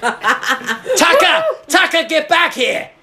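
high, drawn-out mock shout; hammed-up Australian calling out. Calling back the kid on the dirt bike, high mock Australian shout. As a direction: holler slowly after a runaway kid.

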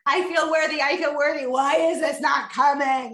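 imitating someone fed up with their affirmations; pleading, exasperated repetition; frustrated. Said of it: "they just get frustrated".

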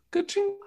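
high rising ka-ching; cash register ka-ching. Pretend. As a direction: chirp ka-ching like a till. Arcade coin sound.

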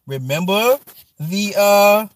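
ordinary talk

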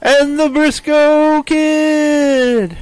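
drawn-out, high announcer call; fighting game announcer. Shown as a demonstration. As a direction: boom out the name like a ring announcer. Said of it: "I have announcer voice".

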